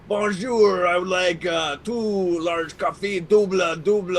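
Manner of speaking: slow, put-on French Canadian drawl; French Canadian accent. Ordering coffee at Tim Hortons as a joke, French Canadian accent. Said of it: "order my coffees that way".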